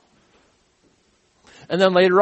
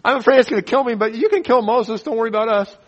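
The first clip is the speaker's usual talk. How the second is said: high, louder mock fear; teasing voice of scared Israelites; mock scared crowd; 6 semitones higher, 3 dB louder, faster than usual. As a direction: joke it as cowardly people. People voiced fearfully, joking.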